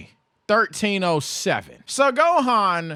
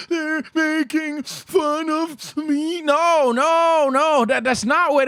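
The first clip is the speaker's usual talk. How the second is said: slow, higher mock whine of a grown-up; mocking adults; 6 semitones higher, slower than usual. Mocking the adults who called him a crybaby, slow whine. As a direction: mimic the adults who misread him, drawn out. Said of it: "adults would gaslight me into being a crybaby".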